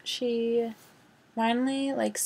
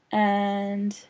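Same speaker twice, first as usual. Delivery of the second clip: usual speaking voice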